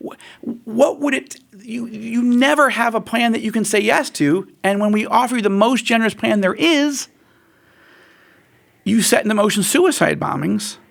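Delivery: high, exasperated outcry; high, exasperated you-gotta-be-kidding. Retold or imitated. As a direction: vent disbelief, pitched high. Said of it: "So Israel was like".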